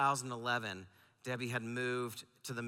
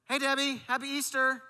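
cheery phone greeting; 12 semitones higher, 6 dB louder than usual. Retold or imitated.

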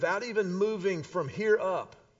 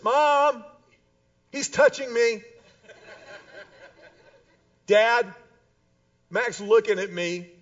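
put-on whiny kid yelling; 4 semitones higher, 6 dB louder than usual. Kids tattling from back seat.

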